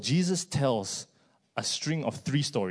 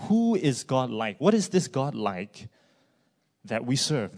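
ordinary talk

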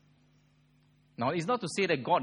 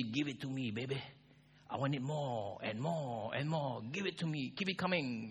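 craving youth; 7 dB quieter than usual. Acted. Preacher imitating youth who want blessings now, soft craving. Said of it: "the youth will say".

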